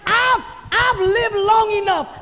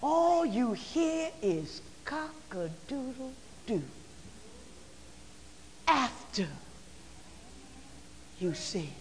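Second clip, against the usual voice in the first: hushed preaching; 6 semitones lower, 14 dB quieter than usual. Acted. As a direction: let it fall to almost nothing. Hushed sermon close.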